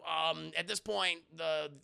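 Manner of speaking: ordinary talk, nothing marked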